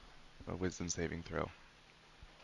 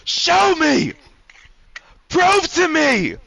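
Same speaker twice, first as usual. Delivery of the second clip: high, stretched-out scream; screamed demand; 20 dB louder, much slower than usual. Acted. Man demanding proof from her, screaming at full force. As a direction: demand it at the top of your lungs. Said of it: "screaming at the top of his lungs".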